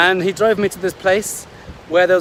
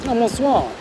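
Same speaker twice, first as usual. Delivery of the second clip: put-on French-accented greeting, pitched up; 6 semitones higher than usual. Kite instructor greeting him in French, light French accent. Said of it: "Apparently I am".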